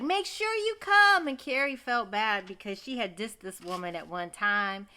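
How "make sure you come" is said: insistent planner. Retold or imitated. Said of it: "So she's like".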